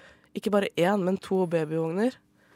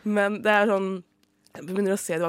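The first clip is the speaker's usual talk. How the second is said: usual speaking voice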